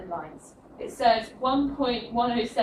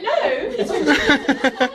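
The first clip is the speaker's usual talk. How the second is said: a loud, laughing, slow correction; 5 semitones higher, 9 dB louder, slower than usual. Retold or imitated. Laughing as she corrects a mix-up about Chile. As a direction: set them straight with a laugh, slowly. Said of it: "You'd be like".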